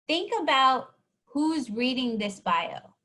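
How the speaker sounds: normal talking voice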